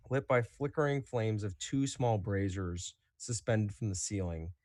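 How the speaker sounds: ordinary talk, nothing marked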